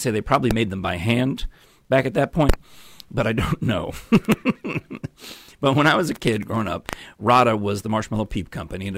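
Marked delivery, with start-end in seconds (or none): 4.0-5.3 high laugh. Real, not acted. Laughs at own joke.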